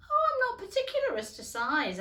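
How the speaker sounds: put-on bright girlish hurry; Alice voice. A narrator's reading. Alice answers.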